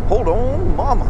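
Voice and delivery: excited rider talking to the bike; thrilled outburst. Real, not acted. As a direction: hang on as it surges. Motorcycle pulling hard, thrilled.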